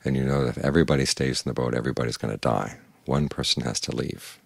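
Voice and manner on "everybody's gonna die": usual speaking voice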